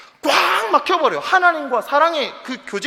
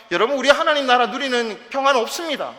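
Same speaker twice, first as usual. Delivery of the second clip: ordinary talk, nothing marked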